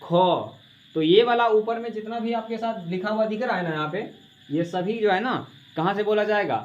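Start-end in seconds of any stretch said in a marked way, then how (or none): 0.0-0.6 ख said louder with a strong breath from the throat; showing where ख is made; aspirated throat demo. Shown as a demonstration. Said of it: "देखो यहाँ पे जोर पड़ेगा ना".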